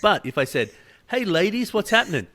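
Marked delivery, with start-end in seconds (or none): 1.1-2.3 high-pitched, breezy hello to the ladies; cheery greeting. Retold or imitated. Man imagining greeting women at pickleball, cheery greeting. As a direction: call out a casual hello, pitched well up.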